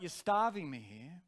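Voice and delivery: normal talking voice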